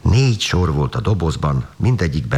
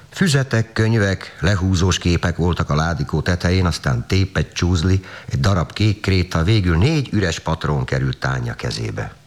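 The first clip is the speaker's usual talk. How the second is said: plain reading voice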